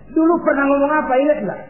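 high, loud demanding question; insistent reminder. Acted. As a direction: press them to remember. Divine voice reminds Ibrahim of vow. (menagih dengan suara tinggi)

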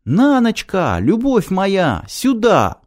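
high, drawn-out call to a beloved; mild theatrical cry, high and stretched. A narrator's reading. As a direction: call out from afar, pitched well up. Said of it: "и кричит".

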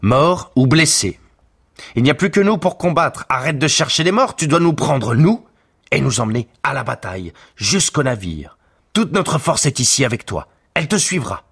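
rally him, loud and insistent; Paris urging Hector back into battle, loud; urgent shouting. Acted. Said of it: "lui cria Paris".

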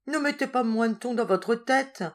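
high, mildly tearful character voice; tearful plea. A narrator's reading. Madwoman begging the warder about her cockchafer, tearful plea. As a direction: plead anxiously, a touch of crying. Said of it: "dit la folle en pleurant".

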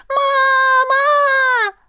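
put-on high drawn-out panicked child's cry; long child's scream. A narrator's reading. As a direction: hold the scream like a terrified little boy. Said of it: "кричал он, срывая голос". (протяжный высокий детский крик)

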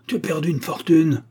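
high, angry accusation; furious yell. A narrator's reading. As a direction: hurl the blame at them. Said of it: "hurla Bark en trépignant".

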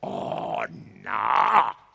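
low, swelling outburst; exclaiming. A narrator's reading. Pilate exclaims against Caifa, forceful. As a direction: let the refusal burst out. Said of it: "esclamò Pilato".

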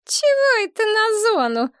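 put-on very high, peevish girlfriend; sour indignation. A narrator's reading. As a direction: protest in a squeaky, put-out voice. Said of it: "скривилась девица". (возмущённо, писклявым голосом)

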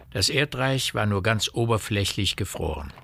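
ordinary delivery

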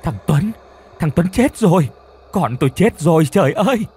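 high, loud anguished wail; keen for the dead son; wailing scream. A narrator's reading. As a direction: grandmother waking from a nightmare wailing for her son. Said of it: "gào rú lên".

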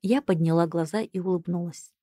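ordinary delivery

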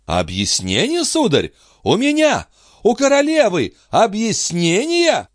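indignant, climbing to very high pitch; outraged disbelief. A narrator's reading. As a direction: throw the demand back, scandalized. Queen outraged at the demand. (возмущённо, всё выше)

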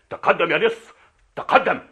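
high, loud command to a prisoner; barked order. Acted. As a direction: drive the captive forward. Soldier orders the captive forward. (أمر صارم بصوت عالٍ)

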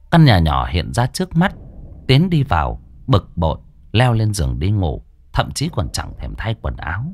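ordinary delivery, nothing marked

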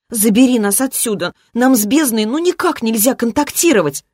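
put-on high, pleading little-girl whine; frightened child whining. A narrator's reading. Ancient girl begging daddy for rescue, frightened childish whine. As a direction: beg like a scared child, pitched high. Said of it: "тоном перепуганного ребёнка законючила она".

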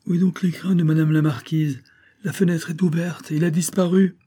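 high-pitched, agitated maid; femme de chambre affolée. A narrator's reading. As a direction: raise the alarm in a high voice. (femme de chambre affolée, voix très haute)

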